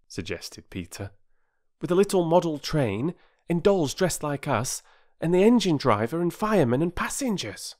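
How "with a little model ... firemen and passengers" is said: put-on high, enthusiastic boy voice; eager boy. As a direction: pile up the details as a keen child. Voices Peter elaborating his idea.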